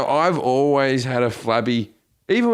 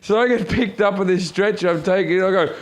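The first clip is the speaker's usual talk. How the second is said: ordinary talk, nothing marked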